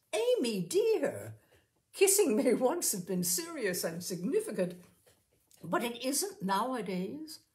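put-on calm her like a child, with a light laugh in it; soothing worldly friend. A narrator's reading. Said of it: "as if it were the shoulder of a child".

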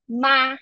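ma held high and level; first tone ma. Shown as a demonstration. Teacher demonstrating first tone, high and level. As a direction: sing ma on one high flat pitch. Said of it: "let me demonstrate how the tones sound like".

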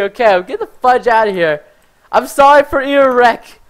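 ordinary delivery

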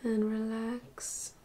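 soft, unhurried meditation voice with long pauses; guided meditation. Acted. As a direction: gently guide them through a body scan, slowly and softly. Leads body scan meditation.

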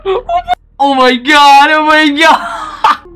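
squealed high, building to a loud cry; hysterical outcry. Real, not acted. Player reacting to game chaos, hysterical repeated outcry.

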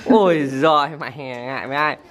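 ordinary talk

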